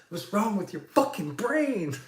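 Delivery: high-pitched mock outburst at the kid; retold angry yelling. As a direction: re-enact yelling at a careless kid. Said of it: "I was fucking still yelling".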